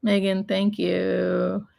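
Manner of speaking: ordinary talk, nothing marked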